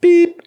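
high, loud beep; imitated machine beep. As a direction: squeak it out like an answering machine tone.

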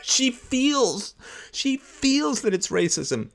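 high, drawn-out, exaggerated pleading; mock upset protest. Debater pleads her feelings over facts, mock upset protest. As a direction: imitate a wounded debater leaning on each word. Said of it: "got really annoyed and angry and upset".

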